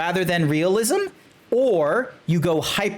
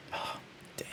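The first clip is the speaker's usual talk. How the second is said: awed whisper; 20 dB quieter than usual. Real, not acted. Quietly awed by the art, whispered. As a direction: breathe it out in awe.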